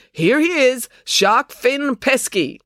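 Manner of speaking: high, loud announcer, name drawn out; announcer's voice. A narrator's reading. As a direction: announce the contestant with a long, slow flourish. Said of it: "using his announcer's voice again".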